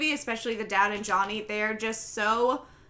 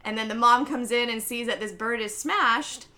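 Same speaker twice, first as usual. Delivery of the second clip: ordinary talk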